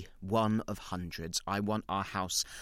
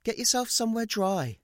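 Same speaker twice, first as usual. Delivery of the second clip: higher, louder voice for the woman; a stranger's caring higher voice; kind stranger; 6 dB louder than usual. Retold or imitated. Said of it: "She actually says".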